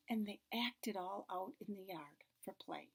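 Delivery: hushed unvoiced whisper. Real, not acted. Reader whispering the story's plot to the child. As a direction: drop to a secret whisper.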